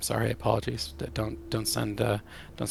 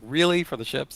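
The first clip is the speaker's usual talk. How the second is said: incredulous objection; 4 semitones higher than usual. Retold or imitated. Retold objection to stealth decision.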